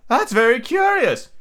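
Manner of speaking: high, loud plaintive turtle; a high wailing creature voice; Mock Turtle voice.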